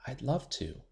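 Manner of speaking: soft, shy near-whisper. A narrator's reading. Shy girl whispering to classmates at lunch, soft. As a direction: barely let the words out. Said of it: "Willow whispered".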